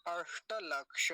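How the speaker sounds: slow अष्टलक्ष with retroflex, never dental, sibilant; corrected model. Shown as a demonstration. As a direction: hold the ष in अष्टलक्ष. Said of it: "वैसे गलती नहीं करेंगे".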